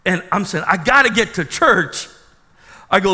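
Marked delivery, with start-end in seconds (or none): 0.7-2.1 higher, louder insistence; urgent retold plea. Trapped pastor telling his friend he must leave, urgent. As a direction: plead it with mounting urgency.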